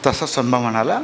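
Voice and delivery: plain reading voice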